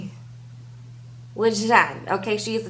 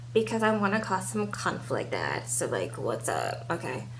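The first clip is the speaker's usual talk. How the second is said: hushed confiding; 7 dB quieter than usual. Retold or imitated. Voicing Lacey confiding to dad.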